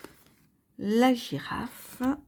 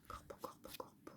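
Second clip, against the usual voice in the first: whispered search; 24 dB quieter, much faster than usual. Real, not acted. Hunting for the raven card.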